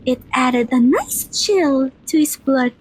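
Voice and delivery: ordinary talk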